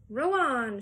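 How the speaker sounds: loud command to row; shouted. Acted. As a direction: bellow it at the rowers. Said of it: "shouted Mister Wonka".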